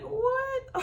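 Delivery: very high-pitched incredulous squeal; disbelief. Retold or imitated. Retelling own disbelief at accusation.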